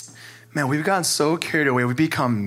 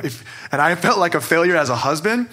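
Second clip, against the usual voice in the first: normal talking voice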